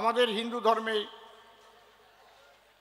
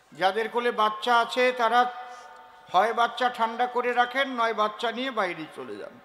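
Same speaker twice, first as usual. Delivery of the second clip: ordinary talk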